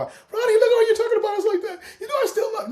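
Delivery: high, fast whining plea; mock her pleading, laughing; high mock-crying girlfriend voice; whiny girlfriend.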